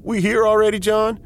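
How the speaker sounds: put-on high, loud kid-like voice; excited kid. A narrator's reading. Co-pilot waking after the flight, high childlike voice. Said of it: "He sounded like one of my kids".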